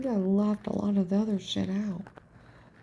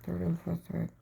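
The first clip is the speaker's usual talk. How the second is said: softer imitation of a man mumbling; imitated mumble; 7 dB quieter than usual. Man behind her in line, mumbling. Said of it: "I can hear him mumble".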